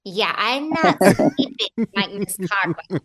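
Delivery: slow, put-on character accent; Miss Codwell accent. Answering the assistant in her character's slow voice. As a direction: slip into the character's drawl-free slow accent. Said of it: "I was like".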